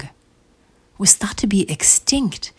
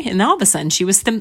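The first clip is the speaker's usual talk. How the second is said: plain reading voice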